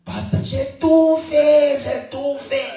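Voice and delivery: high, slow, pleading mock whine; whining complainer. Pastor mimics a member pleading he did everything, high slow whine. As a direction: drag it out like a self-pitying churchgoer. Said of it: "tu parles à quelqu'un".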